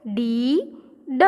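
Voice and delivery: slow ડી, pause, then ડ; blended reading. Shown as a demonstration. Teacher reading the whole spelling to children, slowly sounded out. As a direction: stretch the vowel of ડી, then add ડ separately. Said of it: "આખા સ્પેલિંગનું વાંચન થશે".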